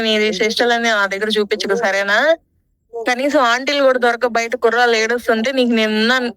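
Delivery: ordinary talk, nothing marked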